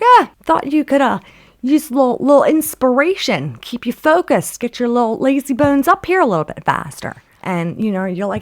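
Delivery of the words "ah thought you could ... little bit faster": high, loud goading reply; playful called-down jibe; teasing taunt. Acted. Acted teasing reply from the climber above.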